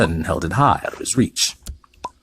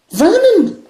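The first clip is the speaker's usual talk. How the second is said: much higher, louder anxious call; frightened wife calls him; quivering voice; 8 dB louder than usual. A narrator's reading. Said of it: "in a quivering voice".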